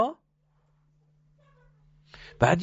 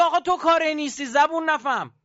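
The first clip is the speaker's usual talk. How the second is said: much higher scolding voice; voicing God scolding; 11 semitones higher than usual. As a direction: scold the stubborn servant from above.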